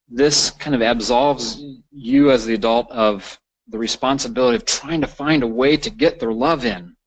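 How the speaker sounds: ordinary delivery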